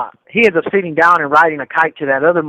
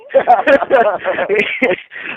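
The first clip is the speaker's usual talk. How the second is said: real laugh; 5 semitones higher than usual. Real, not acted. Laughing at his own story.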